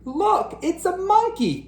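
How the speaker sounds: high squealing cry; excited cry. Acted. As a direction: squeal with delight. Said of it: "cried Clinton".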